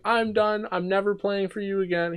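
retold screaming. Kid quitting the team to the coach, high mock yell. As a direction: fling the quitting line up high. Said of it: "I'm screaming, crying at him".

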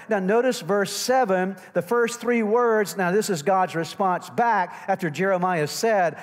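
loud, high sermon delivery; full-force preaching. Real, not acted. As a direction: preach it out to the whole congregation. Preaches to the congregation.

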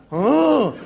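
hammed-up collective gasp; mock awe. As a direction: imitate a family reacting to grave news. Family reacting to the death.

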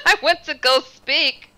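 laughing through the words. Real, not acted. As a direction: giggle as she starts the line. Laughing at her own story.

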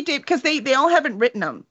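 normal talking voice

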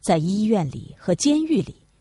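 usual speaking voice

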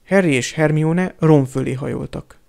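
usual speaking voice

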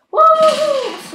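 high, loud hyped greeting; excited whoop. Real, not acted. Hyped greeting mid game.

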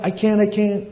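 high-pitched acted refusal; frightened eaglet giving up; panicked protest. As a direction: plead helplessly as you fall.

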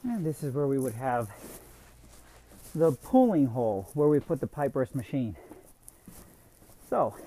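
winded after a long walk; out of breath. Real, not acted. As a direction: talk while catching your breath. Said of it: "excuse me, I'm out of breath".

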